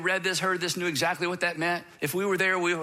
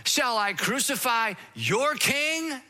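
higher, slow, mocking question; taunting Pilate; 6 semitones higher, slower than usual. Pilate mocks the leaders who hate him, slow taunt. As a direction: needle them with a slow taunt. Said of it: "Pilate hated these men".

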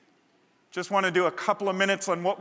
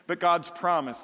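normal talking voice

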